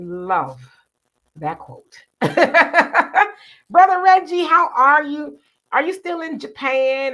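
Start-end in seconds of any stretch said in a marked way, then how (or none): 2.2-3.3 loud, high-pitched cackling laugh; hearty laugh. Real, not acted. Host amused by a quote just read, laughing. 3.7-7.1 loud, high, exuberant hello; delighted greeting. Real, not acted. Host welcoming Brother Reggie back, delighted. Greets a returning viewer after laughing.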